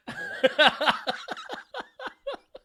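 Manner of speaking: high-pitched burst of laughter; giggling high laugh. Real, not acted. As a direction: crack up. Laughing about Jeremy Renner.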